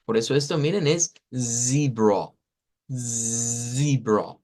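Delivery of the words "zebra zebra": zebra with a long, buzzing voiced z; vibrating z. Shown as a demonstration. Teacher contrasting English and Spanish z, buzzed zebra. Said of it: "con esa vibración".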